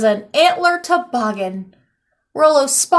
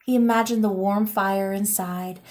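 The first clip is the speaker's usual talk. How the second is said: ordinary delivery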